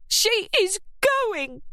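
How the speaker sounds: high, slow incensed voice; insist, voice high and slow. A narrator's reading. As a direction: Kath incensed at Scarlett's aunt.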